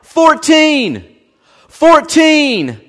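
high, loud call of the play at the line. Retold or imitated. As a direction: shout the number across the field. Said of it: "everyone hears him start calling out".

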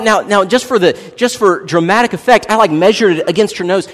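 ordinary talk

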